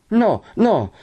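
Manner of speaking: high, alarmed denial; getting frightened. A narrator's reading. Lucia reassures Renzo, starting to panic. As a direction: deny it in renewed fright. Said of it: "cominciando a rispaventarsi".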